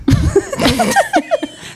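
sudden high squealing laugh; high burst of laughter. Real, not acted. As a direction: let a shrill laugh burst out. Laughing at the monotone joke.

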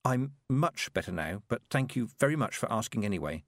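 plain reading voice

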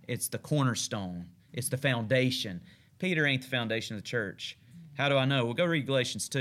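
usual speaking voice

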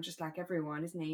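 ordinary talk, nothing marked

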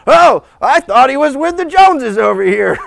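high, loud, flustered realization; mock parental alarm. Parent realizing the kid is missing, comically flustered. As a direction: play a parent caught out.

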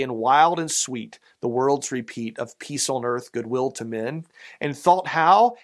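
measured, lilting poem reading; reciting a Christmas poem to the congregation; poem recital. Acted. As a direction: read the verse with a gentle swell.

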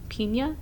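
Pena as "PEEN-yuh?", rising at the end; unsure "PEEN-yuh" for Pena; accented N, questioning. Shown as a demonstration. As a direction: try Pena with an ee vowel and ny sound. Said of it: "there's an accent on that N".

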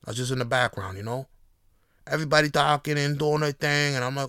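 usual speaking voice

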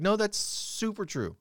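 high, drawn-out contradiction; emphatic insistence. Retold or imitated. Son answering his doubtful mom, emphatically insistent.

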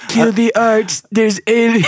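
high, loud presidential caricature; Donald Trump. Acted. President reacting to Space Jam, loud high caricature. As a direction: proclaim it like an alarmed cartoon president. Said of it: "just Donald Trump".

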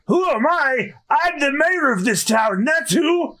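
put-on high, self-important old-timer; high-pitched geezer bluster; indignant old mayor. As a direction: declare your title as if insulted.

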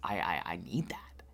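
breathy whispered longing. Retold or imitated. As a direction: whisper it like you crave it. Gushing over a corset she wants.

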